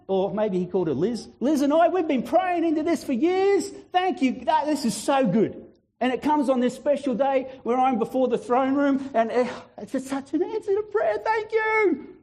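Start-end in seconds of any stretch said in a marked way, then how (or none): 1.4-12.2 high, gushing gratitude; overjoyed, climbing to a squealed thanks; acted excitement. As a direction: play someone thrilled at answered prayer. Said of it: "jump around and get excited".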